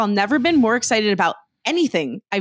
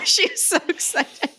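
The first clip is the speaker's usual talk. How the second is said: laughing; 6 semitones higher, 4 dB quieter, slower than usual. Real, not acted. Laughing while mom comes on.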